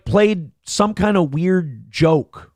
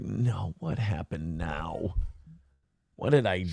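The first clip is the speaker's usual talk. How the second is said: retold fearful murmur; 10 semitones lower, 11 dB quieter, slower than usual. Retold fear, heart in throat.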